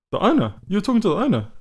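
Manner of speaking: high-pitched incredulous questioning; retold disbelief. Bar worker questioning the punter about the owner, incredulous. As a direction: imitate a bar worker who can't believe it.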